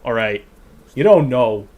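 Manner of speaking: a thick Maine accent, dropped Rs. Shown as a demonstration. Streamer showing chat his Maine accent. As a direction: lapse into a broad Maine accent. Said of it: "lapse into my Maine accent".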